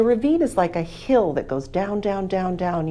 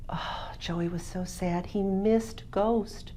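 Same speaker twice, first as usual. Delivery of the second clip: much softer, slower, mournful; soft sad narration; 7 dB quieter, slower than usual. A narrator's reading. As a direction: let the sorrow hush the voice. Dog's death.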